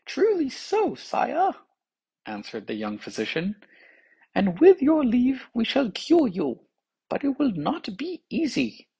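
put-on high, eager young physician voice. A narrator's reading. Young physician tells the king he is bewitched, high voice.

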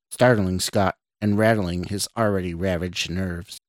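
plain reading voice

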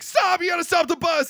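high-pitched retold panicky cry; mock scream. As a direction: imitate screaming without full volume. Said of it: "He starts screaming".

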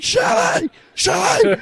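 high-pitched mock scream; panicked yell, played back for laughs; imitated scream. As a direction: call frantically across a crowded hall, voice way up. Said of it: "screaming across the convention".

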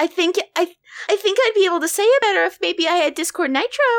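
put-on high, fast cutesy e-girl voice; breathy-cute Discord kitten begging. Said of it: "Do it in the Discord kitten voice".

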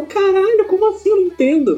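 high, bewildered exclamation; retold astonishment. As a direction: pitch it up in disbelief. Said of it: "E eu". (espanto imitado, voz aguda e perplexa)

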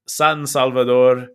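lightly Spanish-accented place name, drawn out; a slow touch of Spanish accent. Shown as a demonstration. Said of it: "with a very Spanish accent".

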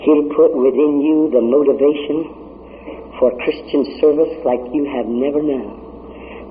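normal talking voice

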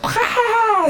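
very high, screechy army yell; mock battle cry. Israel army going nuts as they attack, mock war cry. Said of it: "the Israel army goes nuts".

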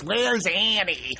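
put-on slow, eerie backwards-style child voice; backwards speech. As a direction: drag it out like reversed speech. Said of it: "And they're talking backwards".

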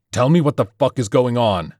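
furious leader yelling at the doctor. A narrator's reading. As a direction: scream the demand at him. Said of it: "he was yelling now".

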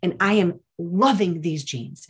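high, loud theatrical outrage, slowing as it goes; dramatic mock fury. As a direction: imitate a teen's over-the-top indignation. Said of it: "just be all dramatic".

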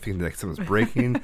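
ordinary delivery

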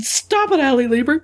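high-pitched, playful protest; mock tearful plea. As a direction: plead as if it's too much to bear. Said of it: "it just makes you wanna cry".

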